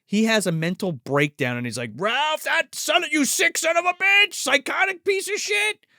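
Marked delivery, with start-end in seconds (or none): 1.9-5.8 mock furious rant. Father's mental breakdown voiced.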